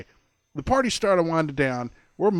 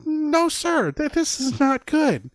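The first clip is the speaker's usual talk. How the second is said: squealed, horrified denial; shocked refusal; 14 semitones higher, slower than usual. Retold or imitated. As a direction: refuse in disbelief. Continues disbelief.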